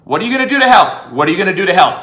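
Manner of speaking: ordinary delivery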